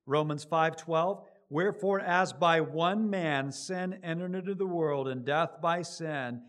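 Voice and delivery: loud, slow proclaimed verse; ringing scripture reading. Acted. Preacher reading Romans to the congregation, slow and ringing. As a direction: proclaim each phrase. Reads scripture at full force.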